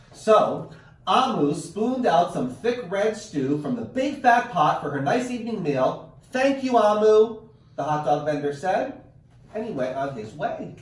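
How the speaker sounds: lively read-aloud storytelling; animated picture-book narration; reading to children. A narrator's reading. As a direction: read it like story time for kids. Teacher reads picture book aloud.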